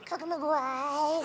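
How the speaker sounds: high, slow fuzzy creature babble; Gizmo. Shown as a demonstration. Voice actor demonstrating his Gremlins role, high creature coo. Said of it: "Gizmo goes".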